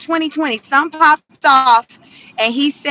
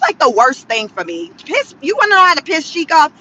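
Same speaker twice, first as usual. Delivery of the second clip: ordinary delivery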